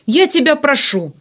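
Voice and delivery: high, loud desperate appeal; pleading. A narrator's reading. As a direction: beg him urgently. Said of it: "взмолился Варданян". (умоляюще, с отчаянием)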